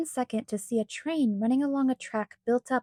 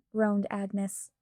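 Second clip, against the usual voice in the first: ordinary delivery, nothing marked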